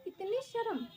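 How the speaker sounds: high, disbelieving question; mock disbelief at the shy groom; incredulous. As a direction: scoff at how shy he is. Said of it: "मैंने कहा".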